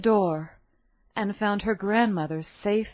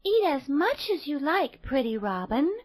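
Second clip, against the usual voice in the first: put-on light, higher girlish voice; little girl voice; 6 semitones higher than usual. A narrator's reading. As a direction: voice a sweet kind girl.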